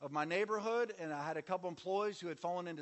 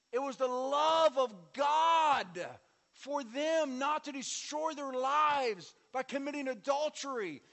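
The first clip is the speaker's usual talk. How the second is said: slow, loud, higher preaching; 6 semitones higher, 7 dB louder, slower than usual. Acted. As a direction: proclaim it slowly from the pulpit. Preaching at full force.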